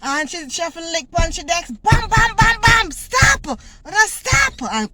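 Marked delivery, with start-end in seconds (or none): 1.7-2.9 loud, high rapid-fire bams; imitated desk banging. Teacher bangs desks for order, loud imitated thumps. 2.9-4.7 high, loud imitated order; teacher's shout. Teacher banging desks to halt the chant, exasperated shout.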